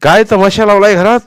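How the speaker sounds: loud, high angry bellow; furious father shouting at the family; roaring father. Acted. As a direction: thunder at the household. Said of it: "सोन्याचे वडील गरजतात".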